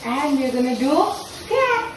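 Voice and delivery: ordinary talk, nothing marked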